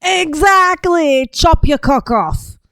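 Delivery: loud, high, drawn-out exclamation; emphatic agreement. Real, not acted. Host cheering on the immigrant in the video, emphatic shout. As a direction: shout your agreement, stretching the words. Loudly agreeing with played clip.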